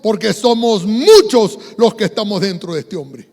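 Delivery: loud, drawn-out name, lightly acted; possessed man answering. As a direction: answer forcefully as the many demons, lightly voiced. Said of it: "el hombre le contestó". (endemoniado respondiendo con fuerza, levemente actuado)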